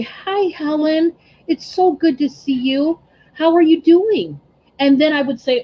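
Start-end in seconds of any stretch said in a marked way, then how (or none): 0.0-4.4 higher, smiling "Hi, Helen" meant from the heart; sincere smiling welcome. Retold or imitated. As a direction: greet her like you're truly glad to see her. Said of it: "So I started to smile and say".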